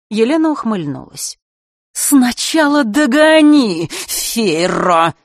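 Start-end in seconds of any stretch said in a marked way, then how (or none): 1.9-5.1 high, loud, slow hissed challenge; hissed taunt. A narrator's reading. Elena dares the Queen to catch her, hissing. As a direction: draw out the dare with venom. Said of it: "вдруг прошипела она".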